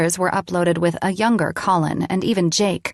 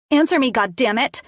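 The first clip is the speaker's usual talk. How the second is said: yelled; 6 semitones higher than usual. A narrator's reading. Lainey yelling at Colin for an answer. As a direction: yell it at him. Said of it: "I yelled".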